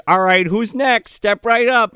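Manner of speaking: high, sing-out call to the next victim; mock showman villain. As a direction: call them up like a carnival barker. Retold villain inviting next victim.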